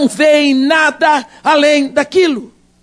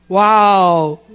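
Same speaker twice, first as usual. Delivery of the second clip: awed wow; 6 semitones lower than usual. Acted. Servant sees fiery chariots.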